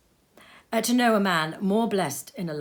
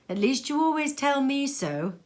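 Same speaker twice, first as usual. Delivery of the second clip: higher sly tease; teasing niece; 8 semitones higher than usual. Acted. Niece teasing Fred, higher voice. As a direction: poke fun gently.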